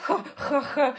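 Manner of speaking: "H, h, h" breathed hard with a laugh; exaggerated h sounds. Shown as a demonstration. Mocking the harsh "H" to a listener. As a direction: push out each "H" like a harsh rasp. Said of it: "this h sound everyone stresses".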